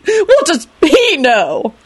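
high, drawn-out indignant screech; angry scream. A narrator's reading. Lady Fanshawe dismisses Lupin, screeching. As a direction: screech it scornfully. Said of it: "Lady Fanshawe screamed angrily".

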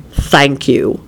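low, offended thanks; mortally offended. A narrator's reading. Quentin to Elizabeth's grudging concession, mortally offended. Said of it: "replied in a mortally offended tone".

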